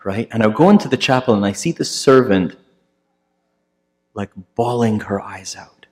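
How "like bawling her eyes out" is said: hushed whisper. Real, not acted. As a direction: drop to a grave whisper. Solemn turn in the story.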